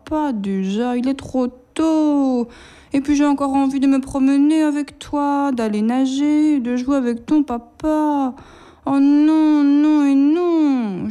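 put-on high, drawn-out childish whining; whiny refusal. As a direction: whine like a sulky kid who won't get up. Mutter will nicht aufstehen.

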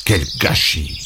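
low, soft grumbling mutter. Acted. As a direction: grumble it under your breath, back turned. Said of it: "en marmonnant".

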